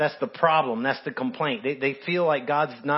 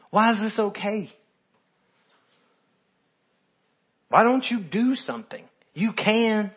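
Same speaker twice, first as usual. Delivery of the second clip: high, pleading, desperate cry to God; anguished plea to God; 8 semitones higher than usual. Acted. Believer crying out to a silent God, pleading. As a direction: voice the hurting believer begging God to act. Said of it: "These are the questions".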